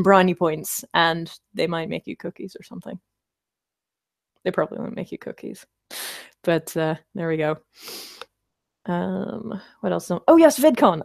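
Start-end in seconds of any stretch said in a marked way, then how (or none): 4.4-5.7 quiet aside. Real, not acted. 10.3-11.0 sudden recall. Real, not acted. Sudden remembering.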